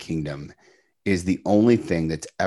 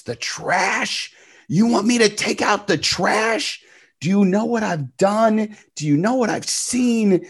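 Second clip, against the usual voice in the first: much higher, louder mock yell, top of the lungs; retold yell; 5 dB louder than usual. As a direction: re-enact snapping at his wife, pitched way up. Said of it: "I yelled at the top of my lungs".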